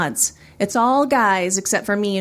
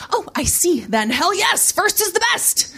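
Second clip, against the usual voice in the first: high, rising cheer; acted eager enthusiasm; gung-ho bravado; 9 semitones higher than usual. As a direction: pump yourself up, higher with each phrase. Covering embarrassment with bravado.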